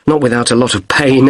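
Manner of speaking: ordinary talk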